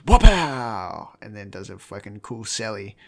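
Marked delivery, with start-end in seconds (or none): 0.1-1.1 loud punchy exclamation; mock triumphant cry. [name] ends her confession to the crowd, loud triumphant cry. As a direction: shout it like a victory blast.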